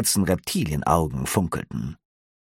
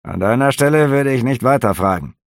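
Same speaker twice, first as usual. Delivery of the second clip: louder, curt threat; warning officer; 6 semitones higher, 4 dB louder than usual. A narrator's reading. Officer warning the innkeeper off, curt. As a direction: warn him off.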